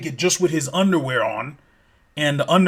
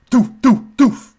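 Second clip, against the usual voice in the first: landing thuds; 8 semitones higher, 3 dB louder, slower than usual. Retold or imitated. Creatures land on the roof.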